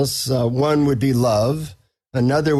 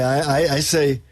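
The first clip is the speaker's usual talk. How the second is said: normal talking voice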